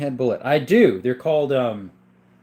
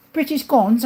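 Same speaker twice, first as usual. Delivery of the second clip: high-pitched mock British accent; 10 semitones higher, 4 dB louder than usual. Echoing a viewer's question about British guns, British accent.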